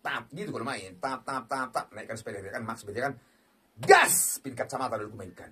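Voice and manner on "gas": very high, loud cry; hyped shout. Real, not acted. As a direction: fire yourself up. Spinning with hype. (teriakan semangat)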